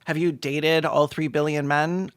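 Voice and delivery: usual speaking voice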